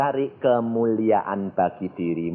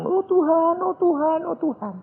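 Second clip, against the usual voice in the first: high-pitched mimicked cries of devotion; imitated crowd pleading. Mimicking everyone calling on God, high pleading cries.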